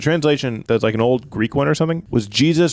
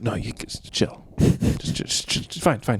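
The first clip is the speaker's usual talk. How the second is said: soft, breathy-free hush to calm them down; whispery reassurance to the guards; quiet calming voice; hushed Jesus; 5 dB quieter, slower than usual. Acted.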